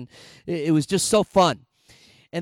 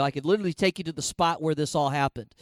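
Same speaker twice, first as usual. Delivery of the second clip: ordinary delivery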